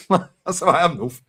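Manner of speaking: high laughing admission. Real, not acted. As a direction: laugh it out, slowly. Laughs at own attitude.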